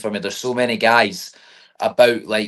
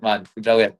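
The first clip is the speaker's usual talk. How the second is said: normal talking voice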